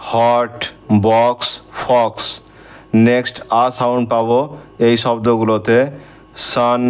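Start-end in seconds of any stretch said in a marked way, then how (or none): 1.1-2.2 বক্স and ফক্স spoken slowly with an open অ vowel; slow model words. Shown as a demonstration. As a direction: draw out the অ in বক্স, ফক্স like a teacher. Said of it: "অ sound যে ওয়ার্ডগুলিতে পাবো". (বক্স, ফক্স ধীরে, খোলা অ উচ্চারণে)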